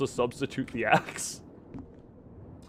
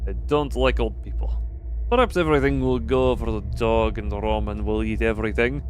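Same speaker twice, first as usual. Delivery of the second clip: lowered, measured reading of a diary entry; 7 semitones lower than usual. Acted. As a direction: read the note low and steady like a journal. Reading diary notes aloud.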